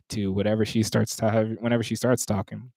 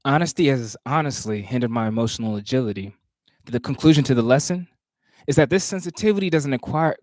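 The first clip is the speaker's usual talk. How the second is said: measured, rhythmic poetry recitation; spoken word performance cadence; spoken word poem; 3 semitones higher, 5 dB louder than usual. Acted. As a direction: perform the poem with weight on each phrase.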